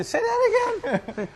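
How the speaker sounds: mocking outsider. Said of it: "they say".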